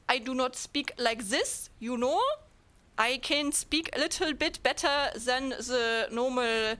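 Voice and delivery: slow, heavy German accent; deliberate, plodding German-accented English; mimicked German accent. Shown as a demonstration. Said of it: "like, mimic it".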